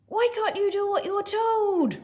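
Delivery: very high, loud, angry shouting; furious roar. Acted. Dad scolding his child for disobeying, roaring. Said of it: "roared Dad".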